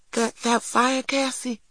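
put-on high, hesitant child voice; anxious little boy. A narrator's reading. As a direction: stammer it high, like a small boy. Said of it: "said Christopher John".

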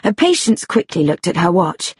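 plain reading voice

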